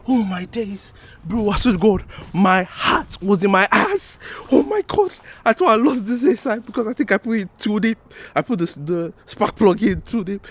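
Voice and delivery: relieved. Real, not acted. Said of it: "that was scary".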